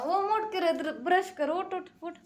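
high, urgent wake-up call; calling son to get up. Real, not acted. Mother rouses sleeping son for school, high and urgent. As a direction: get him out of bed now.

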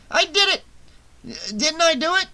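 very high, jubilant crowing; exultant hoarse shout. A narrator's reading. Shelley to Vera after his first win, exultant shout. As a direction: exult like an unlikely winner. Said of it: "he exulted with a hoarse shout".

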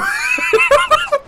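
high shrieking laugh. Real, not acted. Burst of laughter.

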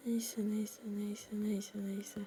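hushed whisper to calm himself down; whispered self-calming. Real, not acted. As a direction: breathe out the repeats in a whisper. Calming himself after dying.

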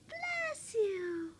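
usual speaking voice

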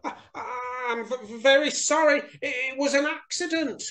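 high, stammering frightened mouse; quivering mouse. A narrator's reading. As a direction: stammer an apology in a tiny high voice. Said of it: "quivered Little Mouse".

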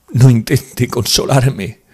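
high, loud, drawn-out sobbing protest; tearful exclamation. A narrator's reading. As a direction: wail it slowly through tears. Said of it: "exclamó ella, llorando".

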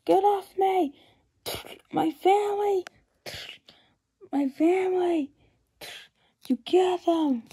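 high desperate pleading; frantic grief. Acted. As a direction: wail for his lost family. Grieving man in panic.

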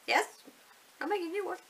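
high, bright eager yes; eager reply. Retold or imitated. Vlogger replaying her eager reply to a school mum. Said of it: "I was like".

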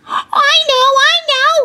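very high, eager schoolboy; high-pitched kid shouting out; excited shout. A narrator's reading. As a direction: burst out with the answer. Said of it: "he shouted".

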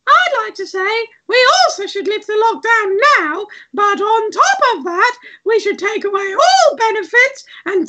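very high squeaky puppet voice; Frog Plug. Acted. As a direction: pitch it far up, a strident little debater. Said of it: "Frog Plug has come on to oppose".

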